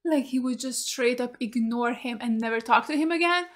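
ordinary delivery

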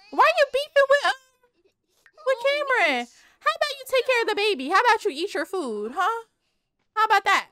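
high, loud scolding mom voice; mock scolding. Vlogger scolds a Sim for beefing, high and loud. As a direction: scold the kid sharply.

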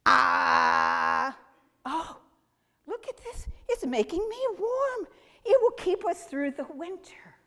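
a grateful elder feeling the warmth; a hint of a delighted old man; old man amazed. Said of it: "the oldest man in the world".

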